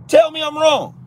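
very high, loud shout of "Tell me I'm wrong"; ranting streamer yelling his dare at viewers; full yell. Real, not acted. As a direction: scream the challenge at the camera.

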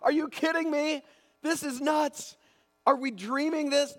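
sky-high elated "are you kidding me"; giddy disbelief. Retold or imitated. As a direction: burst out like you can't believe your luck. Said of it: "like, laughing".

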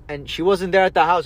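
ordinary delivery

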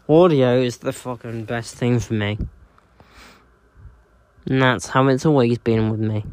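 usual speaking voice

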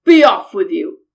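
loud, low troll dismissal; big gruff troll. A narrator's reading. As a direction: boom it out as a grudging troll. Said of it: "said the troll".

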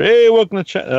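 upbeat post caption. Retold or imitated. Podcaster reading out his Chinatown picture post, upbeat. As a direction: call it out high like a breezy post.

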